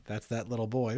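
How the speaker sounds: usual speaking voice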